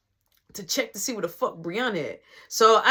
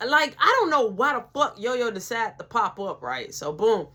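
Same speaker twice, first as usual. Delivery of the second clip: usual speaking voice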